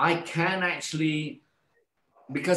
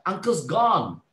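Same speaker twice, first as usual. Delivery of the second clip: higher, louder urgent call; near shout; 7 semitones higher, 4 dB louder, slower than usual. Retold or imitated. Patient telling the nurse the old man died, near shout. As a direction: half-shout the news at the nurse. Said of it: "I nearly shout".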